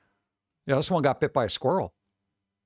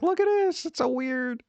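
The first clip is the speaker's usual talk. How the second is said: very high squealing delight; shrill mock excitement; 10 semitones higher, slower than usual. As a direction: squeal at something weird but adorable. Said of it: "where I'm like".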